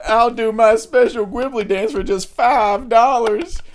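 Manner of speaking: high, loud, slow showman pitch; hammed-up sales pitch, high and drawn out; quoted character voice. Retelling a character offering his special dance, hammed-up high voice. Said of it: "you did the fucking Gwibbly".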